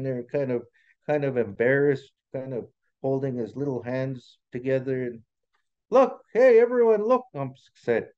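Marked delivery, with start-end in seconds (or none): 5.9-7.3 loud, high attention-getting call; excited high holler. Acted. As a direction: get the whole crowd to look. Beaver calls others to look.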